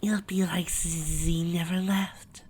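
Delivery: high, whispery, angelic voice; sweet Fluttershy. A narrator's reading. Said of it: "Fluttershy sweet, angelic voice".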